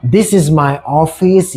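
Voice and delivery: very slow, clear English for learners; slow teacher reading; slow model sentence. Shown as a demonstration. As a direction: spell it out slowly for the class. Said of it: "चलिए अब कुछ example देख लेते हैं".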